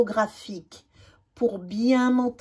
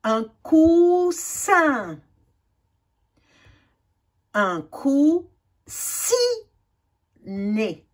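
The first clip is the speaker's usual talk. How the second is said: each word stretched very slowly, syllable by syllable; slow syllable reading; 2 semitones higher, much slower than usual. Shown as a demonstration. Teacher reading practice words slowly for children. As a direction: read the word as if decoding it slowly. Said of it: "reading word list slowly".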